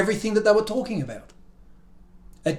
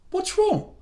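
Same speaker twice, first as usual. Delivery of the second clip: squirrel voice; 13 semitones higher, 3 dB quieter than usual. A narrator's reading. Squirrel asks.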